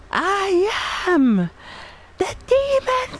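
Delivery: high, loud hammed-up demon declaration; mock demon boast. To chat, declaring himself a demon in a hammed-up voice. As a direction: crow it out like a cartoon demon.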